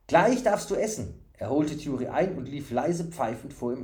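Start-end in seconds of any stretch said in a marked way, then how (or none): 0.1-1.2 high, bright-eyed simpleton promising food; eager fool. A narrator's reading. Fool promising the stranger food, eager.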